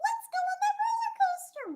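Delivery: high eager mock Jenna voice; Jenna begging. Imitating Jenna begging for another ride, high and eager. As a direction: squeal it like an excited friend. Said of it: "she'll be like".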